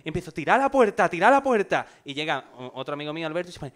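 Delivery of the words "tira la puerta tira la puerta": high, loud repeated order; urgent shout. Retold or imitated. Narrator yells at friends to break the door down, high and loud. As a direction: yell at them to break it down.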